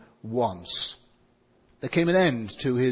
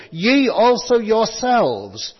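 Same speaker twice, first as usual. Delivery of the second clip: YE ALSO YOURSELVES raised, loud and slow; emphatic; 7 semitones higher, 7 dB louder, slower than usual. Shown as a demonstration. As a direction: ring out every word of "Ye also yourselves". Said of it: "same emphasis".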